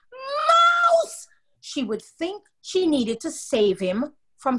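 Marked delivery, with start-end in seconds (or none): 0.1-1.3 very high, loud shriek; scream. A narrator's reading. Ronald screaming to fool his family, very high shriek. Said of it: "every time he screamed".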